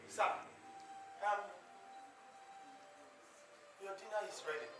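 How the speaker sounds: soft, hesitant reply to the master; timid maid. Acted. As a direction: speak up shyly, half afraid. Timid house girl addresses master.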